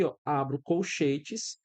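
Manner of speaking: ordinary talk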